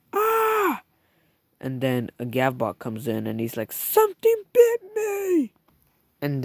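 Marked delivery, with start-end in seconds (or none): 0.1-0.8 very high, loud scream of fright. Acted. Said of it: "Then he hears screaming". 3.7-5.5 very high, loud panicked yell; panicked cry. Acted. Bitten Gavbot bursts in.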